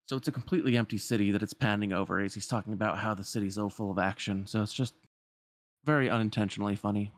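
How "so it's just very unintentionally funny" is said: usual speaking voice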